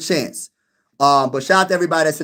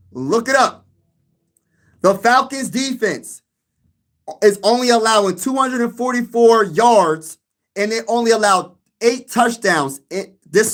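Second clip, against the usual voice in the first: ordinary talk, nothing marked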